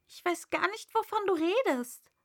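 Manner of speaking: put-on puzzled girl. A narrator's reading. Alice baffled by the pigeon, lightly voiced. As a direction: ask it baffled.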